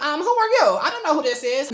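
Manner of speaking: high, loud mock confusion; put-on 'who is this?' indignation; baffled brush-off. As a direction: act out not knowing who's texting. Acted reply to unknown texter.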